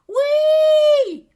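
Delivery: very high, loud squeal; piercing kid-sister shout; little sister squealing. A narrator's reading. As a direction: shriek it back like a small child. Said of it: "piaille ma petite sœur".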